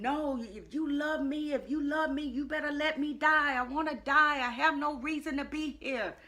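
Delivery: high-pitched, loud desperate protest; retold yelling. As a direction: yell back at God, insistent and unbroken. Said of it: "I'm yelling at him".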